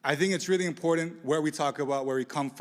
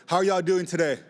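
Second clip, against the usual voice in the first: louder, pumped-up call to the crowd; hyped crowd call; 2 semitones higher, 5 dB louder than usual. Shown as a demonstration. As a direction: shout it out to wake up a tired room. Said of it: "Y'all sound so tired".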